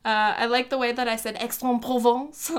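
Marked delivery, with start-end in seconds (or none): 1.4-2.5 imitating her own overdone French accent; mock French pronunciation of the town; super French accent. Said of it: "in a super French accent".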